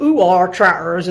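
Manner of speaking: light Devon burr, drawn out slowly; a hint of a West Country Devon farmer; Devon accent. Boyfriend's thick Devon accent, lightly mimicked and slow. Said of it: "has a very thick Devon accent".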